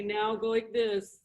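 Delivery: ordinary talk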